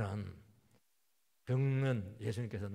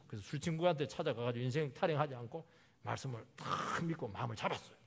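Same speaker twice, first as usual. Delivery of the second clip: ordinary talk, nothing marked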